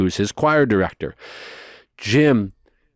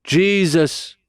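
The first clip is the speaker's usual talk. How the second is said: high, louder, slow prayer call; imitated healing preacher; 6 semitones higher, 3 dB louder, slower than usual. Preacher uncle leading a healing prayer. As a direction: guide them to touch their pain. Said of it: "he's like".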